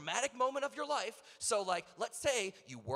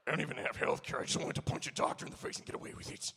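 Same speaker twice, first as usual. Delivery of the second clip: Piccolo quote; 7 semitones lower, faster than usual. Retold or imitated. Piccolo voiced punching doctor.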